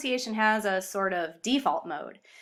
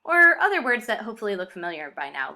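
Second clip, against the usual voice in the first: ordinary talk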